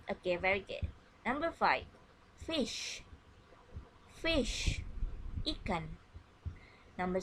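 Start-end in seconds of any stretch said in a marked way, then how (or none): 2.4-4.8 put-on fish said high and bright for kids to repeat; high sing-song teacher word; high model word. Shown as a demonstration. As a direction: pitch the word up high like a teacher to little ones. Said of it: "listen and repeat after me".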